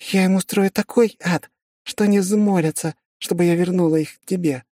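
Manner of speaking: put-on high girl's voice, eager and menacing; girl savoring coming revenge; young woman voice. Girl vows revenge on sinners.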